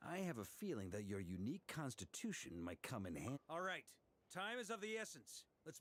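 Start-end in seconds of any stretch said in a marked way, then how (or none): none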